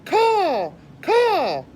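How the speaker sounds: loud, high crow caws; crow cawing. Acted. Crow screaming in the rabbit's ear, loud high caws. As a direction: screech like a crow. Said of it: "screamed a dark message".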